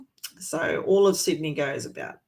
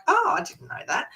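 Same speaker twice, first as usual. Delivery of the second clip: mock surprise; 11 semitones higher than usual. Visitors reacting, high mock surprise.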